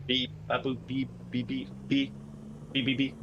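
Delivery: playful mouth-made beeps in short bursts; voiced electronic beeping, evenly pitched; imitated Morse beeps. Imitates Morse code noises.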